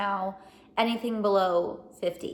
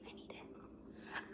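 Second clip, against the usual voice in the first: whispering; 23 dB quieter, much slower than usual. Acted. Whispered craving for coffee.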